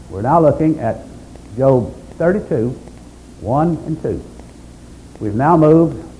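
ordinary talk, nothing marked

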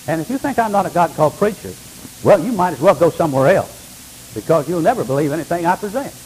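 normal talking voice

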